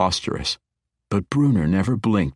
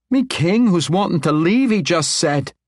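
higher, louder insistent push; pressing; 12 semitones higher, 5 dB louder than usual. Acted. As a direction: press the point home. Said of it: "Dagnabbit pressed".

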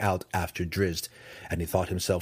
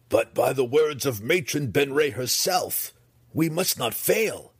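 high, forceful, urgent warning; fervent high-pitched insistence; insistent daughter; 5 semitones higher than usual. Acted. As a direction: press the point on your mother. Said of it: "Briza reminded her mother".

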